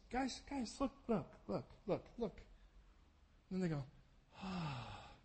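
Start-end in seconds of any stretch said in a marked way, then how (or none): 0.1-2.4 gentle coaxing. Retold or imitated. Jesus showing his hands to shaking disciples, gentle coaxing. 4.4-5.1 long, soft, low exhaled ah; mock awed sigh. Disciples seeing his hands and feet, mock awed sigh. Disciples' stunned response to Jesus.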